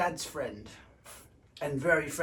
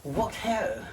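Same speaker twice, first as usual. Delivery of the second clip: posh toff; 3 semitones higher than usual. Pretend. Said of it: "Today I am feeling very posh".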